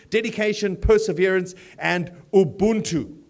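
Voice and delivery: formal MC welcome speech; formal gala address. Acted. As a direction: address the room like a scripted host. Reads scripted gala welcome.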